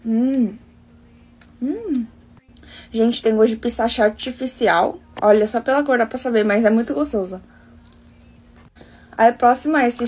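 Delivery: chewing while talking; cold food in mouth; mouth full of ice cream. Real, not acted. Seen 2: chews with closed puffed mouth early, then talks holding cone.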